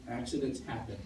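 reassuring. Retold or imitated. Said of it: "and I'm like".

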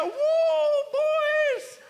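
loud, high whooping gloat; gloating triumph. Acted. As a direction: crow over them in a drawn-out, hammed-up shout. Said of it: "could have had his moment and popped up".

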